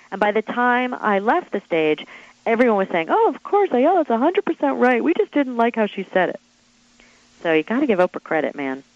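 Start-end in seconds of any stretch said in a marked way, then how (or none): 3.1-6.4 high, gushing imitation of converts; mock eager agreement. Retelling how the hostile audience came round, mock eager agreement. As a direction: go high, overdoing the sudden agreement.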